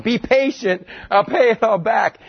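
high, pleading voice for mercy; hammed-up desperate plea; mock plea. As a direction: beg like a ruined man. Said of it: "It's just a plead for his life".

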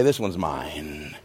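low, soft and slow; God quietly claiming the snake symbol. Acted.

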